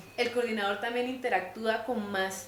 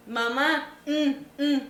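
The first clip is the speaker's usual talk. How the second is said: imitated child's grunts, louder than usual; niño que pide sin hablar; 4 semitones higher, 4 dB louder, much slower than usual. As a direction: grunt for it like a toddler, mock-whiny. (imitando a un niño que pide con quejidos)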